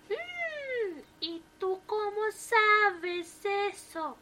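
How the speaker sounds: high, slow suspicious hum and question; the wife narrows in on him. A narrator's reading.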